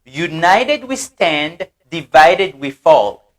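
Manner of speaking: "United we stand, divided we fall" dit lentement, syllabe par syllabe; slow model reading. Shown as a demonstration. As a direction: read the motto slowly for the class to repeat. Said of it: "L'union fait la force". (lentement, "United we stand, divided we fall")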